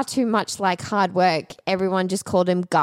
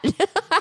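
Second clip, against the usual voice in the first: high giggling burst; short bright laugh; burst of laughter; 11 semitones higher than usual. Real, not acted. Laughing at fat cat.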